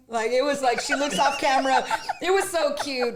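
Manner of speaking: loud, high, laughing gush; amused delight. Real, not acted. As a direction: laugh with delight while talking. Delighted retelling of cute reaction.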